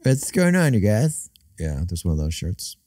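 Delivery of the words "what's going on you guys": high bro greeting; Craig voice. Retold or imitated. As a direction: do the Craig character. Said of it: "Craig, where he's like".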